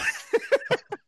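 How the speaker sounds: high unvoiced giggle; laughing under the breath. Real, not acted.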